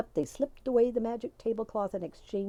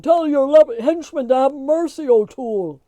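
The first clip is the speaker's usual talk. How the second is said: loud, high, desperate pleading yell; begging; 6 semitones higher, 13 dB louder than usual. A narrator's reading. Magoon begging the tiny men to stop beating him. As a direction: beg for mercy under the blows. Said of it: "begged Magoon".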